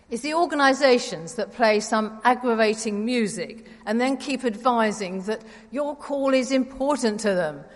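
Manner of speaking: ordinary talk, nothing marked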